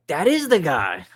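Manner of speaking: proud to confirm it's him; eager coworker. Retold or imitated.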